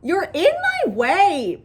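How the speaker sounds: high, loud and slow protest; loud complaint. A narrator's reading. Bat pushing his sister's arm aside, loud protest. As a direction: raise your voice, dragging out each word. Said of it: "Bat said loudly".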